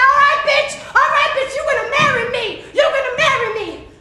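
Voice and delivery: high, loud manic crowing; gleeful hollering. Acted. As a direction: holler it in wild triumph. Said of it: "laughing, hollering, hooting, giggling".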